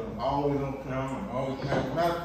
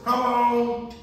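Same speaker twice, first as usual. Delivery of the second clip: higher, louder wife's voice; Carol's voice; 10 semitones higher, 7 dB louder than usual. Retold or imitated. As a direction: imitate Carol calling out. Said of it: "Oh, in my Carol voice".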